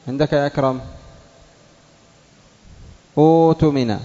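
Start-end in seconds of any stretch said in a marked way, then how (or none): 3.2-4.0 أؤتمن with long uu, no inner stop; waw replacing hamza. Shown as a demonstration. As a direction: say أؤتمن as uutumina. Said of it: "إبدال الواو من الهمزة".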